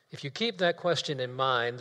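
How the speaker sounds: ordinary delivery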